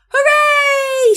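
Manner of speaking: high, loud cheer; shouted hooray. A narrator's reading. The four friends cheering together, loud high shout. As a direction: cheer all together with joy. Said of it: "shouted Little Beaver".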